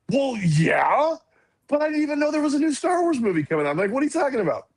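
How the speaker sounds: high, quick incredulous reply; retold disbelief. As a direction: act out an astonished kid. Retells excited, disbelieving reply.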